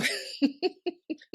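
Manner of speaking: voiceless airy laugh; unvoiced laugh. Real, not acted. Mother laughs at her own adoption joke, voiceless laugh. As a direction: laugh with no voice, just breath.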